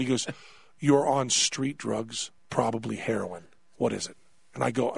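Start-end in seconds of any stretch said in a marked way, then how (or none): 0.8-4.2 slow, low, solemn diagnosis; grave doctor. Retold or imitated. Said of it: "his tone just changes entirely".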